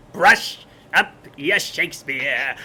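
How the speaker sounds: slow, gruff mobster voice; lightly voiced stage mobster; tough gangster. Quoting Kiss Me Kate mobsters.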